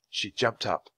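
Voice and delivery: usual speaking voice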